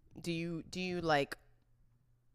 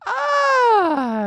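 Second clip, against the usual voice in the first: loud high laughing wail; laughing cry; 14 dB louder than usual. Real, not acted. Cries out after laughing.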